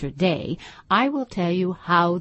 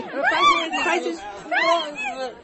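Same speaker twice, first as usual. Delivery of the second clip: much higher, shouted chorus; confused calling out. Acted. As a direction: call out in a jumble. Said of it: "calling out in a confused way".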